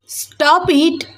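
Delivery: high-pitched angry outburst; angry, high-voiced command to stop; angry yell. A narrator's reading. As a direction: cry it out crossly, pitch jumping up. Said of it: "కోపంగా అరిచి".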